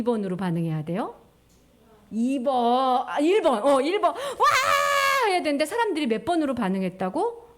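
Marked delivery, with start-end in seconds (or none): 4.2-5.3 high joyful shout of wow; excited cheer. Acted. Teller voicing the people's delighted wow. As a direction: cheer like the crowd just heard great news.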